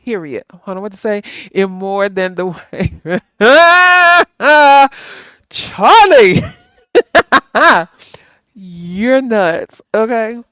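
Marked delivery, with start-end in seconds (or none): 3.1-4.9 big loud burst of laughter. Real, not acted. Laughing at Charlie's joke. 5.5-6.4 high, loud name through laughter; laughing call. Real, not acted. Laughing at Charlie's joke. 6.9-7.8 loud laugh. Real, not acted. Laughing at Charlie's joke.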